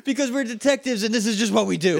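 a hint of a dim tough guy; lightly voiced Bulk. Bulk telling Skull why they're in disguise, in a lightly goofy voice. Cohost voices Bulk's answer.

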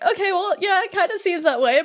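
high, laughing backpedal; flustered Jiminy. Retold or imitated. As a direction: pitch up and laugh through the recovery. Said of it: "He's like".

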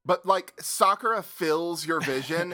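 ordinary delivery

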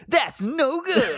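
mock Omega Ranger. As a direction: stretch it out high like a dopey hero. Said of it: "then Omega Ranger's like".

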